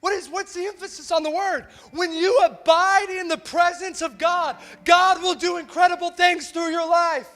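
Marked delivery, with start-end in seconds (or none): 1.9-7.3 loud, emphatic sermon climax; impassioned preaching. Real, not acted. Preacher urging the church to the feet of Jesus, impassioned. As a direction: drive each contrast home with force.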